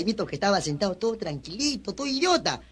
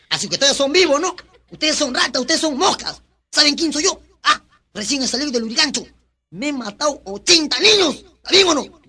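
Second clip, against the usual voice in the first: raised, higher bullying voice; mock intimidation; 5 semitones higher, 4 dB louder than usual. Ex-convict scaring schoolchildren, loud mock bullying. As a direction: hammer each accusation at the class.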